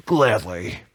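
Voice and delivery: deep smug voice. Acted.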